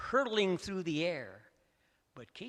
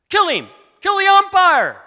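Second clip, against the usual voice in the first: high, loud furious crowd yell; shouted from the stands; 18 dB louder than usual. A narrator's reading. Angry fan in the stands screaming at the umpire, loud and high. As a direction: scream it down from the bleachers. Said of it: "someone shouted from the stand".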